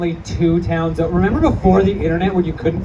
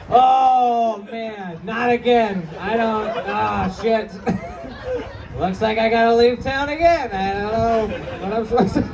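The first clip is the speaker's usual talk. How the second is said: put-on drunk guy; 3 semitones higher than usual. Drunk neighbors voiced in bit.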